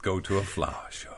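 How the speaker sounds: lightly hammed-up children's reader voice; reading to children. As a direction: read it like an old school primer. Reads a children's primer aloud.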